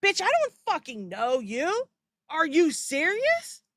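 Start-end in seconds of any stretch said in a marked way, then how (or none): none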